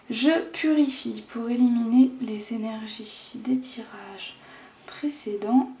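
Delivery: breathy whispered ritual words; whispering. Real, not acted. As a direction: whisper it like a quiet purification. Purifying ritual before the draw.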